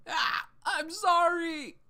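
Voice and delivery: loud, high, slow yelp of sorry; wailing an apology to the harsh trainer; panicked apology. Acted. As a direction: cry out after being hit. Knocked down in training.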